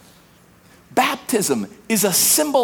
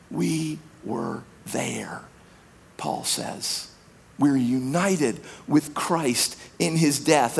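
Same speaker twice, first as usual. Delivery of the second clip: solemn near whisper; 7 semitones lower, 6 dB quieter than usual. Real, not acted. Solemn baptism reminder, whispered.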